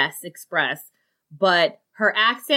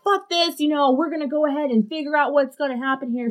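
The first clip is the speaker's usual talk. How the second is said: higher-pitched, brisk quoted resolve; fed-up resolve; 8 semitones higher than usual. Retold or imitated. Tenant rallying the neighbors over the pool dispute, fed-up resolve. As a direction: retell a fed-up rallying cry.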